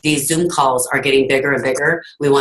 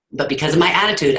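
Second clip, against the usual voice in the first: usual speaking voice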